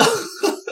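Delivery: real high laugh; cracking up mid-list; high giggle. Real, not acted. Vlogger laughing at old creepy candidates, high giggle.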